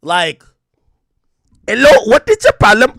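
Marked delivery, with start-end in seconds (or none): 1.7-3.0 high, loud mock Sri Lankan call center voice; mock call center accent. Streamer mimicking a call center agent in a loud, high mock Sri Lankan accent. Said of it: "call center in Sri Lanka".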